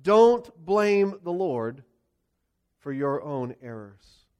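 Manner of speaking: slow, weighty "Don't blame God"; emphatic preacher's point; sermon point stressed. Real, not acted. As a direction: lean hard on "Don't", then let "for your errors" drop away. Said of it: "Point number one".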